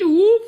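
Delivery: a loud single woof; dog bark. Acted. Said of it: "random animal sounds".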